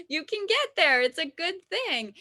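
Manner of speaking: real upbeat pep; excited encouragement. Real, not acted. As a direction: cheer them on with delight. Encouraging right after laughing.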